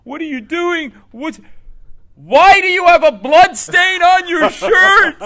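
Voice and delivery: high, loud, slow outraged yelling; screaming boss. Acted. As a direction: build to a drawn-out furious scream. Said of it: "is screaming at me".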